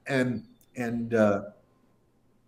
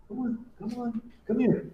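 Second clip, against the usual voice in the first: coaxing the dog; 10 semitones higher, 6 dB quieter than usual. Real, not acted.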